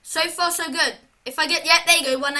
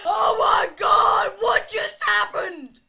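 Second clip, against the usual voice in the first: slow, higher, loud shout of shock; 4 semitones higher, 4 dB louder, slower than usual. Real, not acted. Player stunned after the jump scare, shocked shout. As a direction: shout out in disbelief.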